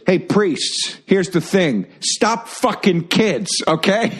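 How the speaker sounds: high, slow mock comedian lecture; hammed-up Dennis Miller delivery; Dennis Miller bit. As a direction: spell it out to the priests, stretched. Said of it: "he goes".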